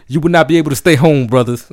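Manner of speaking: fast, loud militant poet. Retold or imitated. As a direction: declaim it like a street revolutionary. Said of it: "militant as fuck, like, staring people right in the face".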